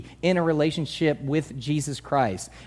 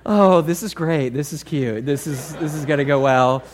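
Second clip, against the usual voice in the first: mock delighted; 2 semitones lower, 4 dB louder than usual. Pastor humoring the engaged couple, mock cheerful. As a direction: gush with a sly edge. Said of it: "you're sitting there going".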